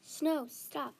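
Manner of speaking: pleading yell. A narrator's reading. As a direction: call out to halt an attacker. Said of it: "Jasmine yelled".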